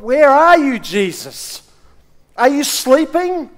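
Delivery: high, loud pleading call; a troubled believer calling for Jesus, loud; desperate plea. Retold or imitated.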